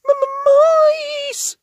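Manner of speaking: very high, loud stammer; frightened stammer. Acted. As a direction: stammer in terror. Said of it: "stammered Lofty".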